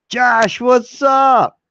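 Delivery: high, loud call-out to a friend; excited greeting. Real, not acted. Greeting a viewer joining stream.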